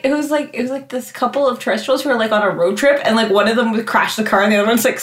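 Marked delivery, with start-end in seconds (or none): none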